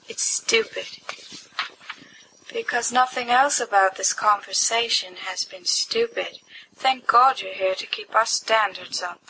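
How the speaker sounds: ordinary talk, nothing marked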